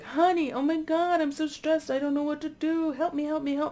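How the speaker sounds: high, whiny plea of a frazzled wife; stressed wife. Retold or imitated. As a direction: squeak it out like a panicking wife begging for help. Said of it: "the wife's like".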